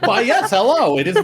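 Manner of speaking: loud, high, hammy posh greeting; over-the-top stuffy manager; silly manager voice. Acted. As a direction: ham up a pompous manager. Players riffing silly manager voice.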